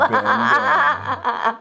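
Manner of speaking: giggling it out high; laughing, high pitched. Real, not acted. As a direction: squeal it through laughter. Joking about soulmate card.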